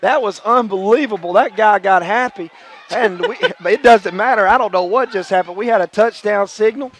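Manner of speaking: loud, high, thrilled play call; excited play-by-play. Real, not acted. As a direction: shout it over the crowd. Home team stops touchdown.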